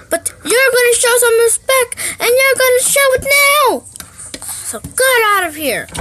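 high, loud tearful command; angry order. Acted. Mom ordering the kid out of the room, angry. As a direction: order them out, furious.

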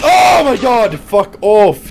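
startled loud yell; jolted by a jump scare; scared horror game streamer. Real, not acted. Jump scare while peeking corner.

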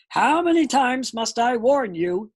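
loud, slow scolding growl; angry growl. A narrator's reading. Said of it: "he growled".

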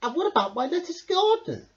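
high anxious hedgehog voice; worried Hedgehog. A narrator's reading. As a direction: fuss over the garden. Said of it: "Hedgehog gasped".

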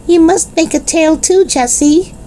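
put-on little boy begging his big sister. A narrator's reading. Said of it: "begged Benny".

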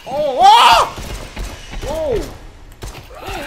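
high, loud startled cries; startled yelps. Real, not acted. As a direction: yelp in shock as it lunges. Startled by attacking zombie.

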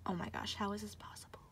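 hushed awed whisper; whispered disbelief. Real, not acted. Another seven, whispering in awed disbelief. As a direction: breathe it in disbelief.

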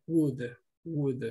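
would as wood, one at a time; silent L. Shown as a demonstration. Teacher drilling the silent-letter rule, would said as wood. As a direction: leave the L out of would. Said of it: "la O y la L es muda".

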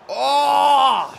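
loud, high "oh!" at a near miss; long high yell of shock; startled shout. Real, not acted. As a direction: cry out as the chance slips by.

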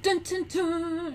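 high, slow sung "dun, dun, dun"; dramatic music hum. Pretend. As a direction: mimic ominous news-reel music, drawn out. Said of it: "Even with the music in the background".

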